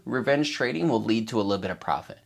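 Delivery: ordinary talk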